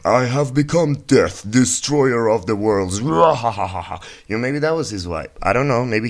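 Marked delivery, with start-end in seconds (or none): 0.0-4.3 slow, hammed-up gloating; gleeful villain. Oppenheimer imagined gloating over the bomb, hammed-up slow glee. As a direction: relish it like a cartoon villain. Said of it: "what if actually his tone was like".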